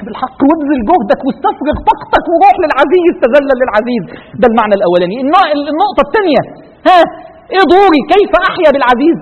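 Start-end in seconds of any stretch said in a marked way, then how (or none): none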